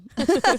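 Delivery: a quick, high giggle at her own joke. Real, not acted. Host giggling after renaming podcast to guest, high giggle. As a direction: giggle bubbling up, real.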